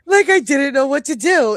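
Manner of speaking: high, loud and thrilled; excited. Real, not acted. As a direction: gush over the gift. Excited about gift box.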